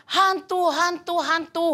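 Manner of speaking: high panicky calls of ghost; frightened shouting. Acted. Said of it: "mereka berteriak-teriak karena takut". (teriakan panik bernada tinggi)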